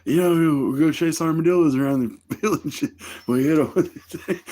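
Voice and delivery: a high, keen buddy laying it out; high buddy explaining. Retold or imitated. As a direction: explain it like the eager friend. Friend explaining, voiced in retelling.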